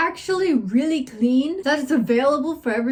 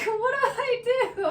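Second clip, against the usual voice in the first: high, fast, scared squeak with a laugh; panicked squeak; 12 semitones higher, faster than usual. Retold or imitated. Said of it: "And I'm just like".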